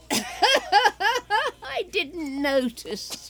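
slow, teasing laugh; sly laugh. Acted. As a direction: laugh knowingly, drawing it out. Teasing remark about the doctor.

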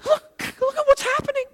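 very high, strained pleading; retold urgent plea. Man begging the preacher to see what's happening, urgent. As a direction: plead in a pitch far above normal.

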